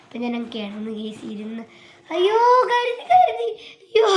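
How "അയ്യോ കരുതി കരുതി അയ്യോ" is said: high, loud laughing squeal; laughing shriek. Real, not acted. Child shrieking with laughter at her viewers.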